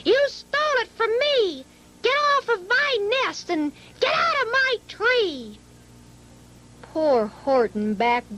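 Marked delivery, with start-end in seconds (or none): 0.1-5.6 much higher, fast furious accusation; angry bird. A narrator's reading. Maisie ordering Horton off her nest, angry and high. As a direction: drive the elephant off the nest. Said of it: "she sputtered".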